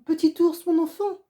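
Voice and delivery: high, loud cry of a mother finding her son; mother's cry. Acted. Inuit mother recognising her lost son on the sled, a high loud cry. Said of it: "s'écrie l'Esquimaude".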